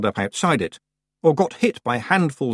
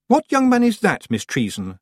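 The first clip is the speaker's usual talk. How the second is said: stony; 11 semitones higher, 3 dB louder than usual. A narrator's reading. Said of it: "as stonily as she could manage".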